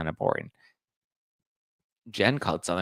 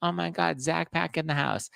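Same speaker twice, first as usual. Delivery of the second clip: much higher squealing fan gushing; 8 semitones higher than usual. Pretend. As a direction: squeal it like an excited superfan. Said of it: "be like".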